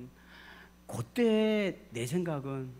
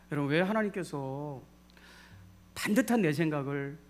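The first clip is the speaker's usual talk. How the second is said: ordinary delivery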